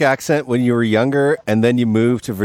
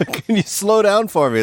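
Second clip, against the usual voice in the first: laughing plea; 8 semitones higher, slower than usual. Retold or imitated. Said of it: "I was like".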